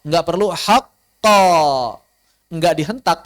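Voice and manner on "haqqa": "haqqa" loud, surging up on the last syllable; suara ditinggikan. Shown as a demonstration. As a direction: throw the end of "haqqa" high and loud. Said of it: "tapi ditinggikan suaranya". ("haqqa" ditinggikan dan dikeraskan di akhir)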